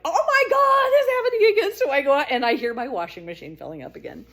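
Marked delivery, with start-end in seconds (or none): 0.0-1.8 high, loud panic with a laugh; spinning in circles, squealing; panicked squeal. Retold or imitated.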